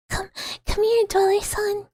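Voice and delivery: a lurker calling its catch; creepy luring call. Acted. Streamer lures the dweller in, creepy high coaxing.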